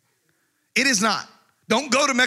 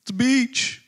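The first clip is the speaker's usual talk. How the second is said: slow, lazy mock surfer voice, stretched out; mock surfer dude; 3 semitones higher, much slower than usual. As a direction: drawl it like a stoned surfer. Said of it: "most people are like".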